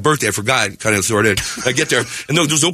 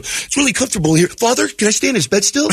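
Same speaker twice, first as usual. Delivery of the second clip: higher-pitched imitation of a foreign student; put-on student voice; exchange student; 7 semitones higher than usual. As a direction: ask father as the cosy houseguest. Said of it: "he talks like this".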